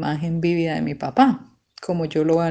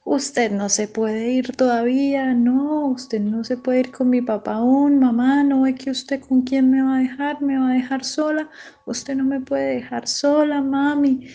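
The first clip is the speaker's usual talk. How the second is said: tearful pleading; 6 semitones higher than usual. Retold or imitated. Daughter begging her mother not to leave her alone, tearful pleading. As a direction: plead in a high, imitated crying wail. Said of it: "llorando, gritaba".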